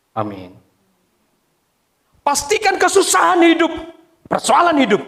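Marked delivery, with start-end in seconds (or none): 2.2-5.0 high, forceful pulpit call; preaching exhortation. Real, not acted. Pastor urging congregation through hardship, high forceful preaching. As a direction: urge the congregation with raised voice.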